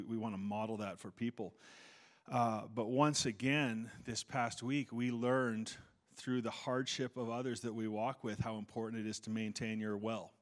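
normal talking voice

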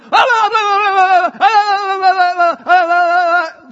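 loud, high mock shouting blah blah; mocking yelling. Mocking TV pundits yelling at each other, loud and high. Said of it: "They just yelled".

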